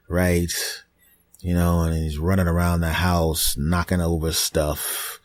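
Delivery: ordinary talk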